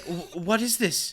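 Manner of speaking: slow, stammering mock fright; hesitant, mildly scared stammer; Benedict Cumberbatch, scared. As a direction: imitate a nervous reader stumbling at the script. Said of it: "he, like, gets scared".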